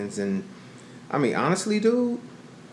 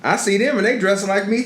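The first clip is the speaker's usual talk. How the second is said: ordinary delivery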